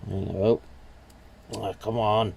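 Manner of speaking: usual speaking voice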